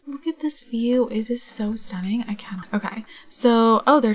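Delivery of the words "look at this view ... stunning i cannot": soft breathless whisper; awed whisper. Real, not acted. Admiring the fairground view, awed whisper. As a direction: let the view take your breath.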